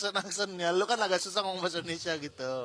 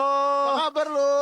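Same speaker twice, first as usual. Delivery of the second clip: excited greeting; 9 semitones higher, 7 dB louder than usual. Pretend. Acted greeting of returning friend.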